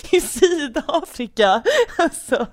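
real laughing, struggling to get it out; helpless laughter. Real, not acted. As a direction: finish the claim while cracking up. Cracking up over own shaky claim.

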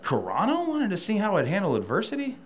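pitched high in disbelief, rising at the end; incredulous question. Retold or imitated. Echoing the referee's explanation, high incredulous disbelief. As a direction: sound baffled by the reason.

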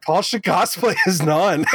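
says it while laughing. Real, not acted. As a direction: laugh through the words. Joking through laughter.

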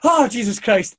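big relieved outburst; relieved exclamation. Retold or imitated. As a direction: blurt it out, flooded with relief. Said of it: "I could have hugged him".

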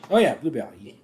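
ordinary talk, nothing marked

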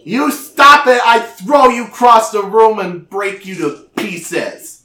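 high, loud shriek; giant girl tantrum. Acted. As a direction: scream it like a furious giant child.

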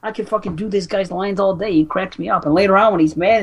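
normal talking voice

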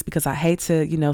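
ordinary talk, nothing marked

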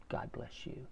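breathy whisper, barely voiced; a hushed, whispered reply; whispered blessing. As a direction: whisper it back to him. Repairman answers the blessing.

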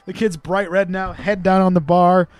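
ordinary talk

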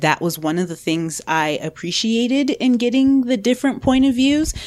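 usual speaking voice

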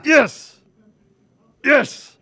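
high, loud celebratory yes; excited cheer. Real, not acted. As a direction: cheer it out, pitch jumping up. Cheering as the chart appears.

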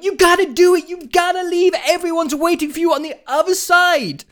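high, loud pleading yell; urgent screaming. Retold or imitated. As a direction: shout at him to go now. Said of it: "two people screaming at me".

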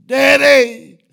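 loud, high, drawn-out cry; imitated little voice screaming; child's scream, imitated. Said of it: "that little voice screamed out".

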